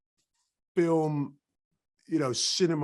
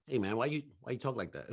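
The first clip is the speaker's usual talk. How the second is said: Eddie Murphy; 4 dB quieter, faster than usual. Retold or imitated. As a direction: lightly imitate a square American guy.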